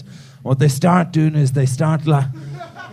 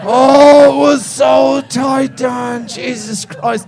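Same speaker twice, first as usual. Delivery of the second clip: mock strained exclamation; 8 semitones higher, 5 dB louder, slower than usual. Reliving the squeeze.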